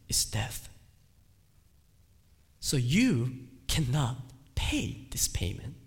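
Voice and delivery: hushed solemn whisper. Acted. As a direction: breathe it out as a grave warning. Solemn drop after preaching.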